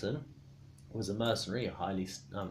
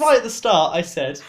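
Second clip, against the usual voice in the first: much higher and louder insisting; 11 semitones higher, 17 dB louder than usual. Real, not acted. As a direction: shout over them. Loud heated insistence.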